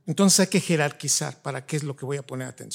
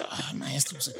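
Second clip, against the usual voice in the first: breathy mock whisper; 3 semitones lower, 12 dB quieter than usual. Student muttering back to the teacher, whispered. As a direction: whisper it aside like a sheepish student. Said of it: "el maestro así".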